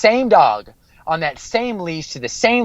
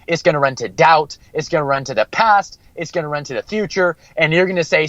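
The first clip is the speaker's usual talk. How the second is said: ordinary delivery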